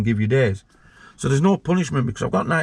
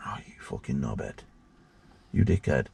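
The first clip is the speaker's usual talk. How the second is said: hushed whispered insult under the breath; mouthed insult; 11 semitones lower, 9 dB quieter than usual. Retold or imitated. Officer mouthing to colleague, hushed whispered insult. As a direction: whisper it like a look between colleagues. Colleagues' silent look voiced.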